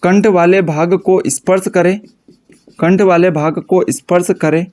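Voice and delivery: slow, broken phrases with long pauses for writing; slow dictation. Shown as a demonstration. Teacher dictating definition to class, slow and paused. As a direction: dictate it so students can keep up.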